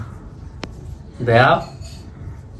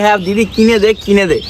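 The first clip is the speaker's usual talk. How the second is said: mimicking aunt; 8 semitones higher than usual. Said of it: "এই মাসি আমাকে বলবে যে".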